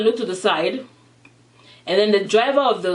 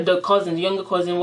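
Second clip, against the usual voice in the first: usual speaking voice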